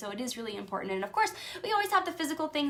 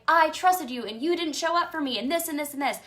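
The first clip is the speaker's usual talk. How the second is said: high, loud accusing complaint; retold angry complaint; 6 semitones higher, 5 dB louder than usual. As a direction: accuse them, worked up. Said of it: "And I was like".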